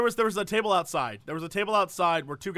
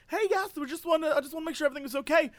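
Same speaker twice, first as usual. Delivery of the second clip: high-pitched imitation of cheery staff; mock peppy coordinator; 9 semitones higher, much faster than usual. Coordinator checks on the table, mock-cheery and high. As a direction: chirp it like an overattentive host.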